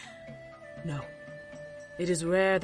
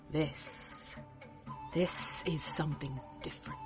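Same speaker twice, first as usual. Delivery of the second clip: hushed; 3 semitones lower, 6 dB quieter than usual. Acted. Seer reveals the crystal.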